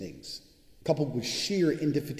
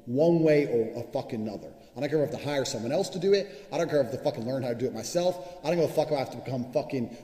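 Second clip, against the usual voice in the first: usual speaking voice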